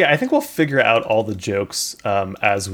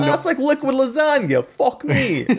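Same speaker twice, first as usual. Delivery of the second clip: put-on Brooklyn accent; 11 semitones higher than usual. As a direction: savor it like a Brooklyn guy. Continuing the Brooklyn Italian bit.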